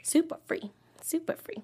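unvoiced whisper. Host repeating the plug back to his cohost, whisper. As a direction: hush it like a secret. Echoing the free podcast plug.

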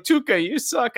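lightly voiced high yell; retold kid's yell. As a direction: imitate a kid jeering at a player. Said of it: "the kid yelled".

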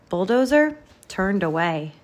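plain reading voice

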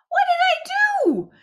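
very high, loud, slow disbelief; shocked. Real, not acted. Actor finding audition cancelled, high shocked cry.

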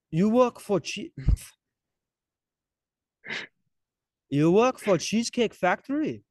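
retry the Israeli guy voice, cracking up; imitated Israeli guy. Diner asks the mall cop if he works there, laughing mock Israeli voice.

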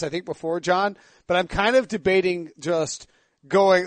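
usual speaking voice

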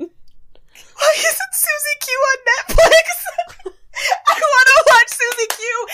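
high laughing squeal; excited squeal. Real, not acted. Excited outburst about favorite show.

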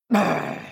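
rolled, growling brr of displeasure; witch's angry brr. A narrator's reading. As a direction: trill a cross brr. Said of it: "said Baba Yaga".

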